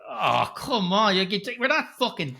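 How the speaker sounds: slow, high drawn-out complaint; exasperated groan. Retold or imitated. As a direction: beg the film to stop. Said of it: "I was like".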